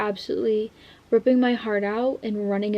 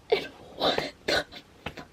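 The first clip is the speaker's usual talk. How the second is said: stunned whisper; 7 dB quieter, slower than usual. Real, not acted. Reacting to a shocking page.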